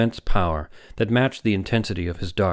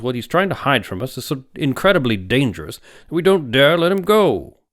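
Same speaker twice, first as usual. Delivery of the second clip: louder, higher, emphatic insistence; forceful big doctor; 6 semitones higher, 6 dB louder than usual. A narrator's reading. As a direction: hammer home that the man is insane.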